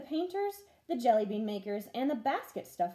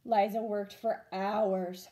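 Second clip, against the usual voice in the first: ordinary delivery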